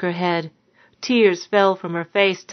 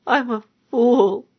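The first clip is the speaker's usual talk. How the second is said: high, tearful sob of regret; a touch of quiet weeping; sobbing quietly; 5 semitones higher than usual. A narrator's reading. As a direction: cry it out softly, self-reproach. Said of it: "she sobbed quietly".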